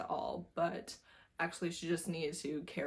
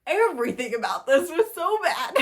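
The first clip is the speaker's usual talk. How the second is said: louder and higher, laughing through it; cackling at a terrible read; 5 semitones higher, 8 dB louder than usual. Real, not acted. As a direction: crack up at how bad it was.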